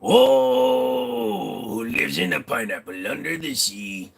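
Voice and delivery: hammed-up cartoon sea-captain call; mock pirate call. Streamer quotes a pineapple theme song, mock captain voice.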